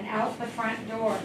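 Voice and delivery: ordinary talk, nothing marked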